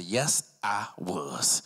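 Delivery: slow, drawn-out soldier's answer; mock military. Suspect answers the officer about service, mock military. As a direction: answer like a proud soldier, low and deliberate. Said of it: "was you in the military".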